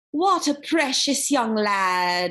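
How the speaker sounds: slow, silky foreign-tinged British voice; strange British-ish accent. A narrator's reading. Guard sneering at young Percy, slow silky accent. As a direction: draw it out sweet and cold like a python. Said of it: "He had a strange accent, British maybe".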